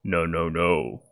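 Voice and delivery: a hint of gravel, unhurried and restrained; gravelly voice. Frank the dragonborn stops a man drawing a knife, lightly gravelly. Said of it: "Frank's gravelly voice just go".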